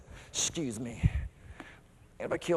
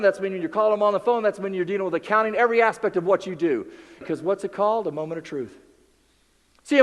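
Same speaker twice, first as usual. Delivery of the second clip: ordinary talk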